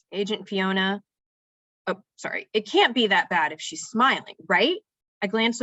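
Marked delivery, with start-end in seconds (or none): none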